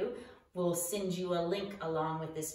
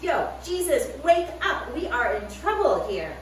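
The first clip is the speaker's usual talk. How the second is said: high, loud holler to wake him; 6 semitones higher, 8 dB louder than usual. Acted. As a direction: shout to wake the sleeping Jesus. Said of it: "they started to holler at them".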